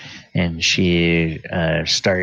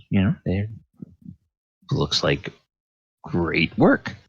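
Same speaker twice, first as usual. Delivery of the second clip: normal talking voice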